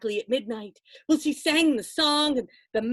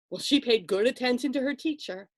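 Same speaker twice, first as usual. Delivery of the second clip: ordinary delivery, nothing marked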